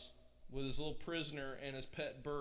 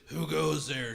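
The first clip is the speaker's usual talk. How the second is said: loud troll challenge; troll shouting up from under the bridge; 8 dB louder than usual. A narrator's reading. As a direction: call out gruffly like a troll. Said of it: "shouted the troll".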